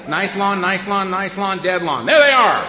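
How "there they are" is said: louder, higher gotcha; triumphant shout. Acted. Neighbours spotting the bad lawn, with a loud, higher gotcha shout. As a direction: call it out like catching someone.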